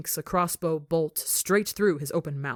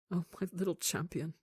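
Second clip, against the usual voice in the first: tender father; 6 dB quieter than usual. Acted. Father comforting rescued son.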